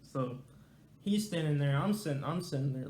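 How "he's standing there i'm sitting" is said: plain reading voice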